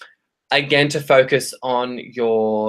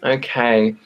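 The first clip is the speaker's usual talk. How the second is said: usual speaking voice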